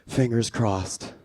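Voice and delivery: slow, low, resigned customer; doubtful well-wishing. Retold or imitated. Old customer to store worker, doubtful well-wishing. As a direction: draw it out slowly and low.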